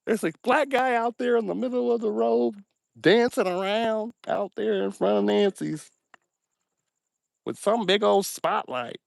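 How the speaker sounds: very high-pitched, drawn-out put-on caller voice; hammed-up high voice of someone calling the sheriff; mock caller. Mimics caller reporting him to the sheriff.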